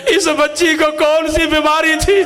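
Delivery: asked while sobbing; crying question. Real, not acted. Preacher repeating the washer's question, crying. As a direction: weep through the question.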